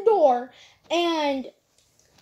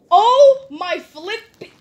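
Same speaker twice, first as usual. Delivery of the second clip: shocked exclamation; 3 semitones higher, 8 dB louder, slower than usual. Real, not acted. Shocked at the kid.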